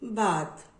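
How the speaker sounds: "bath" as one blended syllable, falling; sounds blended. Shown as a demonstration. Teacher showing children both sounds together, blended. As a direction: join ba and tha into "bath". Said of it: "Say both sound together".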